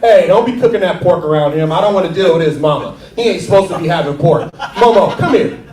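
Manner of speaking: put-on loud scolding uncle, ordering the kid around; imitating Uncle Mark. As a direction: call out sharply like an uncle taking charge. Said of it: "my uncle would be like".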